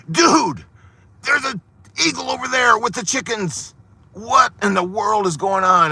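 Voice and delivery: rude, high-pitched demand; a little mean. Acted. Naturalist rudely demanding answers from the farmer, mean and high. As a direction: push him with a mean, pushy tone. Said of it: "I'm gonna go a little mean".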